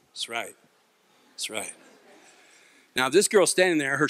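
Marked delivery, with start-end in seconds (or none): 0.1-1.7 very soft, low mock-tough mumble; low under-the-breath bravado; quiet swagger. Man acting tough once the thugs drive off, low quiet swagger.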